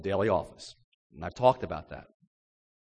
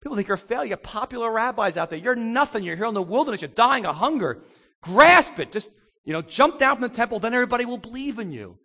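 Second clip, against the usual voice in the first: a hint of a sneering tempter, rising higher; devil's taunts; 8 semitones higher, 5 dB louder, faster than usual. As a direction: goad him with insults to jump. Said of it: "remember they said".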